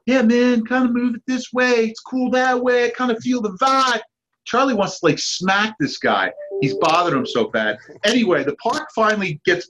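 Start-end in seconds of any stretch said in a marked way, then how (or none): 0.1-4.0 higher, easygoing stoner voice; lightly voiced mellow dude drifting along; a hint of a chill skater bro; laid-back surfer dude. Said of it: "you got this, like, guy".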